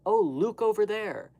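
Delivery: "Luke" said with a long oo in a high, loud mocking voice; silly put-on voice mispronouncing "Luke"; mock Luke for look. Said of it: "Do you say Luke?".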